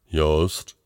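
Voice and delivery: low, easygoing voice answering a call; laid-back colleague. Acted. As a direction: answer low with a questioning lift. Colleague answers, relaxed on holiday.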